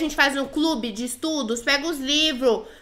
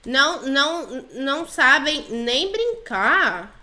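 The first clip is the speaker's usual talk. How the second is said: "não sabem nem brincar" stretched out slowly; slow dictation; slower than usual. Pretend. Player types "não sabem nem brincar" to the girls, slowly dictating. Continua escrevendo, devagar.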